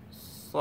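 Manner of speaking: "স" with tongue kept off the palate; heavy s sound. Shown as a demonstration. Teacher showing tongue position, heavy gapped "স". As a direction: say a hollow "স", leaving a gap under the palate. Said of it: "আপনার তালুতে লাগবে না".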